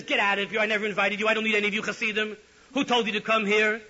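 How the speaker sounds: high, loud furious yelling; screaming. Retold or imitated. The Rebbe screams at the Chassidim in the study hall, furious. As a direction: throw them all out at the top of your voice. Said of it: "began to scream at everybody".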